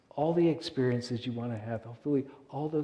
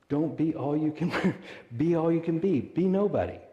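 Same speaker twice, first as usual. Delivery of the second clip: laughs mid-slogan, higher and louder; amused, raised delivery; laughing; 5 semitones higher, 4 dB louder than usual. Real, not acted. Laughing through army slogan.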